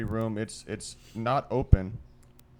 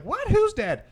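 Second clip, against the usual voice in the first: shrill, much higher, louder squeal; shocked question; 6 dB louder, slower than usual. Retold or imitated. The girls hear the news, squealing shocked disbelief. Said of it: "they're like".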